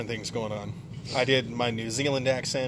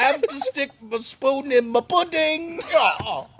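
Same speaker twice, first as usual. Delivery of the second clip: high, slow hammed-up impression; Bill Cosby; 11 semitones higher, 3 dB louder, slower than usual. Clowning about pudding for laughing friends, as Bill Cosby. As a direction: stretch it out in a silly high celebrity voice. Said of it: "Straight out of left field is Bill Cosby".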